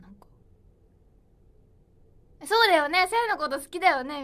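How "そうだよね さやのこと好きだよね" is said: much louder, higher happy burst; light up at the comments; delighted reply to the chat; excited. Real, not acted. Reacting happily to comments.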